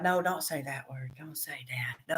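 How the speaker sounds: imitating students' urgent whisper; hushed whisper. Students hushing their teacher, in an imitated whisper. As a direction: whisper it like begging her to stop. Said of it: "And they're like".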